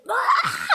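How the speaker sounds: short high cry of frustration; frustrated scream. Retold or imitated. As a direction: let out a yelp of frustration. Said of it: "I was just like".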